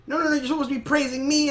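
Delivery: high-pitched mock indignation; indignant protest. Peridot as the others check on Pearl, indignant protest.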